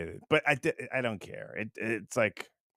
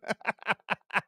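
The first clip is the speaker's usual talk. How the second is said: laugh; 8 semitones higher, 6 dB quieter than usual. Real, not acted. Laughs after reading joke.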